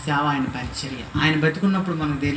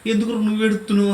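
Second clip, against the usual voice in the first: aunt's concerned question; 5 semitones higher, 5 dB louder, faster than usual. Retold or imitated. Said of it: "అంది".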